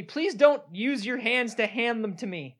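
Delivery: high, loud nervous office chatter; awkward office worker. Acted. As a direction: play an uneasy coworker. Acted awkward office scene.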